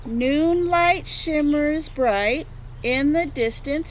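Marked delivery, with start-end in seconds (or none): none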